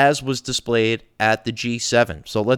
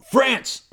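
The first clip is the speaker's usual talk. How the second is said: high barked word disguised as a cough; cough-shout France; 12 semitones higher than usual. Acted. Host mocking France with a cough-shout. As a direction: fake a cough that says France.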